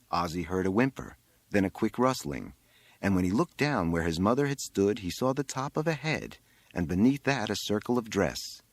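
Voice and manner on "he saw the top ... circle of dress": usual speaking voice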